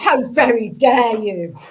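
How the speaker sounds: slow, loud, hammed-up indignation; drawn-out comic affront; mock outrage. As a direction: pretend to be grandly offended. Mock offence at rude joke.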